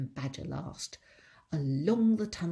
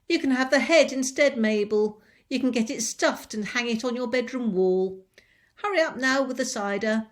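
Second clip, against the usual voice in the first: loud, higher commanding voice; bossy mistress; 6 semitones higher, 11 dB louder than usual. Acted. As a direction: order the maid about. Mrs Bean ordering Mabel.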